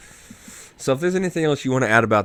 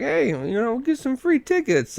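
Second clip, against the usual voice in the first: mock giddy excitement; 13 semitones higher than usual. Imitating the old man seeing free tickets, giddy mock excitement. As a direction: lift it into a giddy, pretend-excited lilt. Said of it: "instantly giddy and excited about it".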